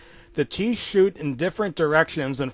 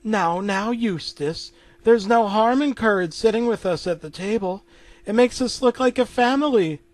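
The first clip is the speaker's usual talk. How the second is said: lightly voiced higher placating wife; Muriel placating; 7 semitones higher, 3 dB louder than usual. Wife calming Eustace about the dog at the table, placating. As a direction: soothe a grumpy husband in a higher voice.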